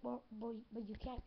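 ordinary delivery

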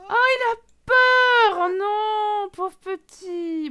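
high, long-drawn wail, easing off; pitying wail. Real, not acted. Player to a frightened little character, pitying wail. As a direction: wail in sympathy, stretching the vowels. Wails in pity for game character.

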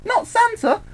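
very high, dismayed squeak; high-pitched mock dismay; shocked squeal. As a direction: squeal it in hammed-up horror. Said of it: "Harley screamed".

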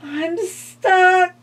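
high, frightened skeleton cry. A narrator's reading. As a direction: cry out, trapped and scared. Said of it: "cried the skeleton".

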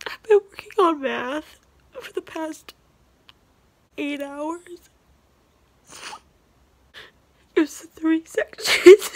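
tearful and exhausted; high, wavering voice near tears; crying, worn out. Real, not acted. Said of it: "And I can't stop crying".

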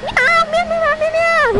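high mock meows; meowing at the cat. Streamer meows at the cat, high-pitched mock meowing.

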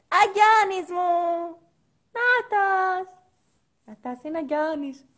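high, stretched out exclamation; excited cry. Acted.

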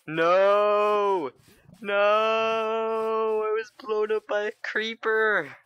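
high, stretched-out lament; high, slow moan of defeat; mournful wail. Real, not acted. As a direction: whine the loss slowly, drawing out each no. Repeats wail after game death.

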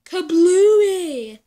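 loud, high kablooey blast; explosion sound. Acted. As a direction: shout the boom like a bomb going off. Bomb explodes in the story.

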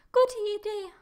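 loud high squeal; panicked girl shrieking agreement before running, loud and high. A narrator's reading. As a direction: squeal it as you bolt. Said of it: "quietschte Emily".

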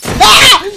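sudden high, very loud scream; a real jump-scare shriek; scream of fright. Real, not acted. Gamer caught by a jump scare, piercing scream.

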